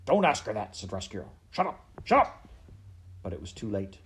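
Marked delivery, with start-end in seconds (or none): 0.0-0.8 loud, higher interjection; urgent objection. Acted. Rat forbidding the princess to ask, urgent and loud. 1.5-2.5 high, loud repeated order; frantic silencing. Acted. Rat trying to stop the question, frantic yell.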